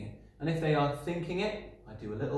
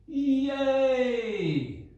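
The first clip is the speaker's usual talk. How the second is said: a much higher, louder mock scream of joy; hammed-up grandma cheering; excited scream; 12 semitones higher, 5 dB louder than usual. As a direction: let Grandma squeal with relief. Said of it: "And she screams".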